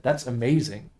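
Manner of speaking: ordinary delivery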